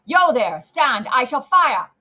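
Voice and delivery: loud, high, drawn-out challenge; roared warning. A narrator's reading. Guard halting a galloping rider, roaring a warning. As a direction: holler the threat across a distance. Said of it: "sang out as loud as he could roar".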